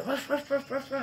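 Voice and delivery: high, yappy mock barking; imitated dog barks. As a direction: bark like an excited puppy.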